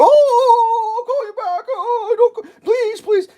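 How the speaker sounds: very high fake wailing; mock crying. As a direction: beg in a hammed-up tearful squeal. Mocking official panicking at threat.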